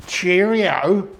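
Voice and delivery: high, loud "Cheerio" toast; hammed-up imitation of a drunken cheerio; copying Francis. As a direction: belt out a tipsy toast the way the friend does. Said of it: "No, I was copying you, Francis".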